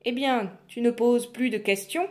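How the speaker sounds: lightly voiced witch taunt. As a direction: tease her slyly.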